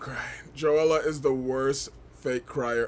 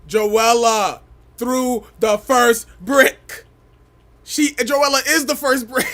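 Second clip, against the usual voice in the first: loud slow laughing declaration; laughing hype; 6 semitones higher, 9 dB louder, slower than usual. Real, not acted. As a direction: proclaim it through laughter. Laughing hype about Joella.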